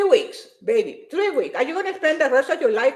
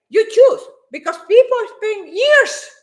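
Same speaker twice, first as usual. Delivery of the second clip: forceful outburst; 8 semitones higher, 7 dB louder than usual. Real, not acted. Emphatic point to viewers.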